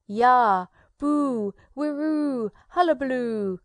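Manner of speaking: put-on loud whooping yells to scare a child; wild war cries. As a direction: bellow each cry like a showman conjurer.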